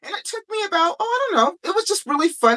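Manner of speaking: high, bubbly recollection; bubbling over about the book; excited. Real, not acted. Author recalling writing her first book, high and bubbly.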